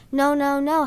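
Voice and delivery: loud, panicked cry of no; shouting. Acted. Harry waking from a nightmare, shouting no in panic. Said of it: "Harry shouted".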